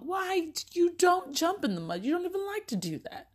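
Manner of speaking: high, disbelieving questions; incredulous mom. Retold or imitated. Mom questioning son who wants to jump in mud, high incredulous. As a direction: question him in comic disbelief.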